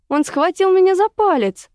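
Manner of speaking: high, loud yelp of alarm; startled squeal. A narrator's reading. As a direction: squeal it out, pitch leaping up. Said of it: "Вдруг взвизгнула Женя".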